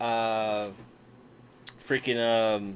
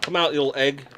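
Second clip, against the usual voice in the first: ordinary talk, nothing marked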